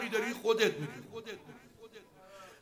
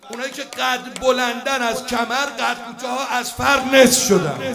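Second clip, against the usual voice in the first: rising preacher's shout; 4 semitones higher, 7 dB louder than usual. Acted. Preacher's rising battle narration.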